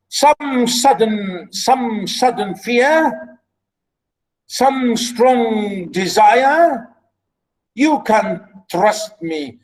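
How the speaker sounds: theatrical verse reading with lifted pitch; king offering help. Acted. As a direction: declaim to your kneeling people. Reads king's offer of help.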